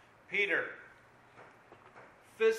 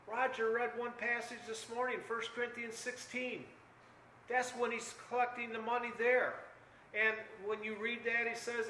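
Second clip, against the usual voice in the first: ordinary talk, nothing marked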